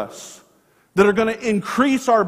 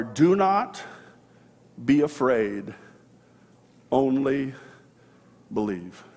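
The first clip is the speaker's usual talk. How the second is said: soft reassurance; 6 semitones lower, 5 dB quieter, slower than usual. Acted. Jesus comforts the grieving father.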